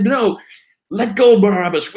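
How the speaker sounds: hammed-up crowd demanding Barabbas; mock crowd shout. Preacher retelling the crowd before Pilate, mock shout.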